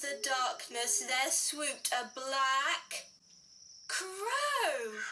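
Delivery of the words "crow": ordinary delivery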